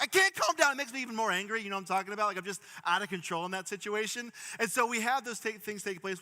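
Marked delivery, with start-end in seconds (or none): 0.0-1.6 high, loud frustrated shout; exasperated shout at being told to calm down; angry outburst. Retold or imitated. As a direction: blow up like you're losing it. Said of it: "And I'm like".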